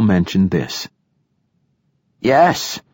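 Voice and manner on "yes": short, higher-pitched snap; snapped. A narrator's reading. Old poet cutting off the narrator's objection, snapping. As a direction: snap it back impatiently. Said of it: "snapped the old poet".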